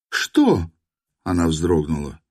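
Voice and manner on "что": high startled question. A narrator's reading. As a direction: flinch and ask. Nastya startled. (испуганно, высоко)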